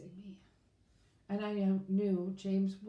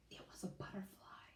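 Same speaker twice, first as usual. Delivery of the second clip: whisper; 16 dB quieter, faster than usual. A narrator's reading. Narrator hushes at butterfly.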